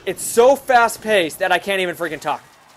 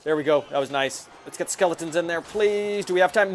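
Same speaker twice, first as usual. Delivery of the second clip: normal talking voice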